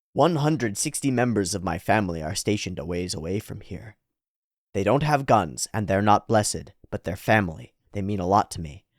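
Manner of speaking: low, subdued character voice; solemn foreign lady. Acted. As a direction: make a grave, earnest offer. Voices flying lady's offer.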